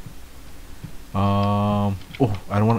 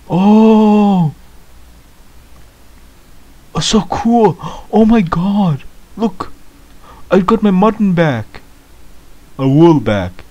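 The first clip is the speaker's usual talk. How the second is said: much higher and louder, overjoyed; thrilled; 8 semitones higher, 7 dB louder, slower than usual. Real, not acted. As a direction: burst out with delight at getting it back. Gets her lost items back.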